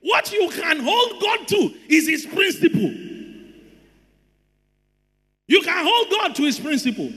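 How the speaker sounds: high-pitched, emphatic sermon delivery; preacher driving the point home; full-force preaching. Real, not acted. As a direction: preach it with urgent force to the congregation.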